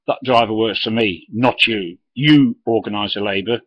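retold stern telling-off. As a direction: put the boss firmly in his place. Jackie telling off the boss.

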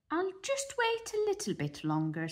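high patient mother duck; high gentle mother bird. Acted. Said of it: "said Mother Duck".

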